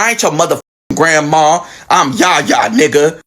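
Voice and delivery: low, gruff grandma correction; tough grandma. Retold or imitated. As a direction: drop low and set him straight. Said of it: "She's like".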